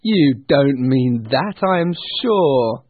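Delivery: slow, loud, drawn-out protest; protesting nephew. Acted. Nephew coaxing his uncle, protesting. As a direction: lean on the words, lifted voice. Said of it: "said Scrooge's nephew".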